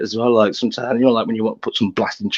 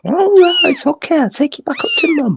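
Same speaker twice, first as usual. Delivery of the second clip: very high, cooing reassurance. Real, not acted. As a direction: coo to a little animal in a tiny high voice. Soothing a baby animal.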